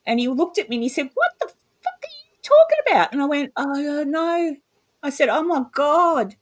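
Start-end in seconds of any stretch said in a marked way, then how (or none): 1.1-3.1 high, louder mock-baffled outburst; retold incredulity. Retelling her partner's reaction to her, incredulous. 3.5-4.5 high, drawn-out helpless reply; retold despair. Answering her baffled partner in the retelling, helpless despair. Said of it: "absolute despair and depression". 5.3-6.4 high, slow moan of dismay; retold despair. Telling her partner of the dark feeling, despairing. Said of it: "absolute despair and depression".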